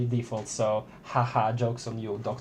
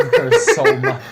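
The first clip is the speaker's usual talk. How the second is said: amused gush, louder through laughter; laughing; 9 dB louder than usual. Real, not acted. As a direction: laugh breaking into the words. Breaks up laughing over character.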